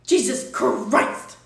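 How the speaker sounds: loud, slow, stretched-out; fed-up outburst; exasperated shout. Real, not acted. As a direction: blow up in disbelief. Exasperated at chat again.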